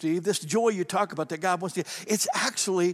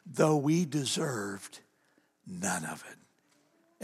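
hushed sermon point; 3 dB quieter, slower than usual. Acted. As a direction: let it fall to a hush to land the point. Preacher drops to tender point.

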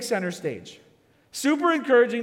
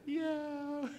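sheepish; 10 semitones higher, 10 dB quieter than usual. Retold or imitated. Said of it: "I said".

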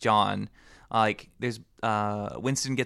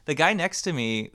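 ordinary delivery

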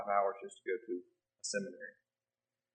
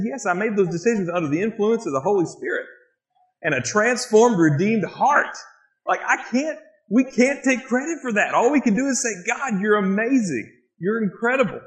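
rising to a high, loud, fervent pitch; impassioned testimony; 12 semitones higher, 9 dB louder than usual. Retold or imitated. Son insisting to his mom that God deserves credit, impassioned. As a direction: preach it to her with growing conviction.

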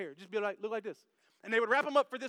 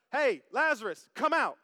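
loud, higher summons to someone; Jesus calling out; 3 semitones higher, 7 dB louder than usual. Acted. Preacher plays Jesus summoning Lazarus, calling out loudly. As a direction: call out firmly toward a tomb. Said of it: "Jesus walks up".

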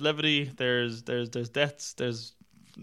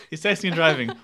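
louder, through leftover laughter; laughing; 7 semitones higher, 5 dB louder, faster than usual. Real, not acted. Reviewer retelling the film's accident, laughing. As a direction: repeat it while still cracking up. Breaks up retelling his own line.

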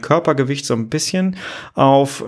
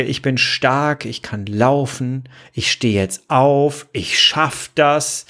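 slow, deliberate affirmations; slower than usual. Shown as a demonstration. Coach slowly showing the listener an affirmation. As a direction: say each line slowly, like a mantra. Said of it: "ob ich mir die ganze Zeit sage".

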